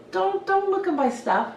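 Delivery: high, fussy mock protest; playful mock warning. Hairdresser messing around with a girl client, playful mock warning. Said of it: "this is what I mean by messing around".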